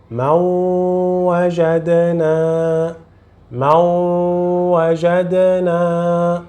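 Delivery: ما وجدنا with a long, level nasal hum on the join; nasal ghunna. Shown as a demonstration. Teacher shows learners the Quran rule, long nasal ما وجدنا. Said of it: "em pronunciar o som nasal".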